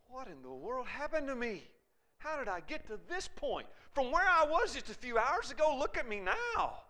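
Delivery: high-pitched, acted bewilderment; dismayed disbelief. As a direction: voice climbing as he questions himself in dismay. Acts out Peter's dismayed reflection.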